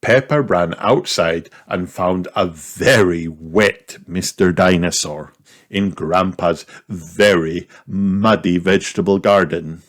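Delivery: usual speaking voice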